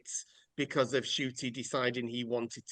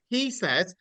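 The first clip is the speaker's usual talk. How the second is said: usual speaking voice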